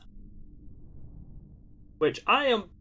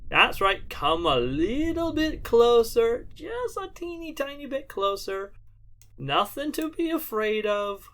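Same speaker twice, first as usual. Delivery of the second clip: mad scientist; 6 semitones higher than usual. Pretend. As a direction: lure them in like a cackling villain. Coaxing enemy into mines.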